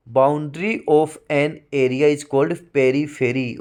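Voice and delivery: slow, deliberate reading of a definition; slow dictation. Shown as a demonstration. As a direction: read it slowly so students can write it down. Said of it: "repeats definition slowly".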